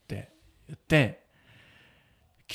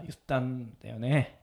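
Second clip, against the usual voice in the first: normal talking voice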